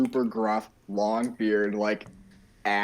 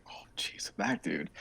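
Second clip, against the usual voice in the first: whispered nervous "oh, jeez"; hushed wary aside; 6 semitones lower, 11 dB quieter than usual. Pretend. Crew member daunted by the engineer, whispered. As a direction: breathe it out, wary of him. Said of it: "very hard to be like".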